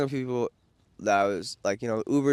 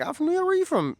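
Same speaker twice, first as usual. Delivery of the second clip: much higher, eager retold reply; excited. Vlogger replaying his eager answer to the girl, excited. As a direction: pitch up with growing excitement.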